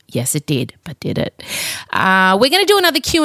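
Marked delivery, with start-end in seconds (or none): 0.1-1.4 soft, breathy conspiratorial but did it; mock-conspiracy whisper; whispered tease. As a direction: hush it like a secret. Teasing conspiracy aside.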